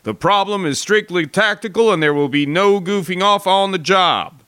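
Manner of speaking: loud, high barked orders to the troops; brief the men like a drill officer; commanding officer calling out the jump plan; officer's briefing. Retold or imitated.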